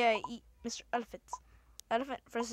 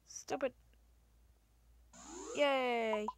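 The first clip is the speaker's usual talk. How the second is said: ordinary talk, nothing marked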